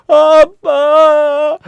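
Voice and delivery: high, very drawn-out mock wail; hammed-up tearful first word; crying zombie daughter. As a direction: wail for dad as a zombie child. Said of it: "좀비인데 막 울어".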